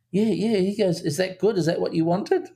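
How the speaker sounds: a light impression of a child asking if he did well; imitating the boy. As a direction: play the keen young actor checking in. Director retells boy's eager reply.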